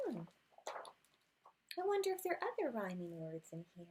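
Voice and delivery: plain reading voice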